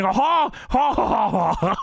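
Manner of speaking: high exaggerated "ha, ha, ha" guffaw; crazy mock laugh. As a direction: imitate an inhuman, overdone laugh. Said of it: "this is a crazy laugh".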